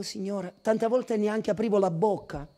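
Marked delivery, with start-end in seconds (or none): 0.0-0.5 faint, hushed plea to the Lord; timid prayer. Retold or imitated. Preacher retelling his old half-hearted prayer, hushed. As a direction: barely open the mouth.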